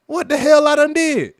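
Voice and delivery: loud, high, disbelieving protest; man on the ground shouting his protest; retold outraged yell. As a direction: yell it, baffled and pinned down. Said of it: "And I'm like".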